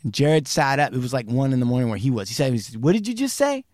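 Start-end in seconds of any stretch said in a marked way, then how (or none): 2.8-3.6 high, rising disbelief; startled question. Retold or imitated. Jared on the late call reacting, startled question. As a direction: ask it high, as if jolted upright.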